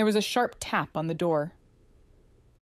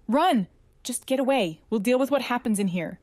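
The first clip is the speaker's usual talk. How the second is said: urgent shout; 6 semitones higher than usual. A narrator's reading. Urgent warning over comm.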